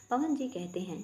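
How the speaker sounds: ordinary delivery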